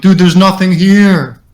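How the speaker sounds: high, loud, drawn-out call; acted insistent shout. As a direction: shout it slowly back to a friend. Acted shout back to scared friend.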